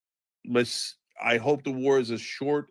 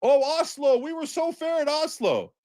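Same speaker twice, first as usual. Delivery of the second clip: mock whiny voice; 12 semitones higher than usual. Mocking the Israelis' claims.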